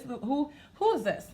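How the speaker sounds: normal talking voice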